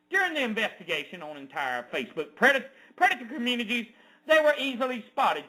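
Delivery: ordinary talk, nothing marked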